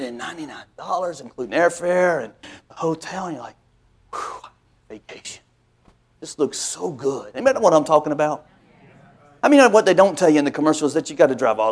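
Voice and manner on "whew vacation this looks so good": soft, slow, airy longing; dreamy sigh. Retold or imitated. Preacher imitating a viewer dreaming of vacation, soft sigh. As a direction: breathe it out, dreaming of the trip.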